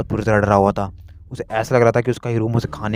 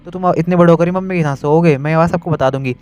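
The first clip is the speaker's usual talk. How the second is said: higher, louder mocking little girl; teasing sister; 6 semitones higher, 4 dB louder than usual. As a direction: sister threatening to tell everyone. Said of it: "गांगो बोलती है". (छोटी बहन की चिढ़ाती ऊँची आवाज़)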